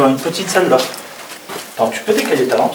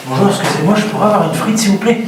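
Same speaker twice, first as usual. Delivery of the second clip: lightly voiced polite customer, a bit higher; 8 semitones higher than usual. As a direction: ask politely like at a counter. Joke ordering fries in ruins.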